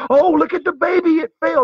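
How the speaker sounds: high, loud, alarmed cooing parent; fussing parent. Acted. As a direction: overreact to a toddler's tumble. Imagined parent overreacting to a fall.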